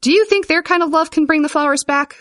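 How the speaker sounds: put-on high, scoffing boy's jeer; hooting. A narrator's reading. Boy scoffing at the uncles' love, hooting high. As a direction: hoot the question mockingly. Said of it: "hooted one of the boys".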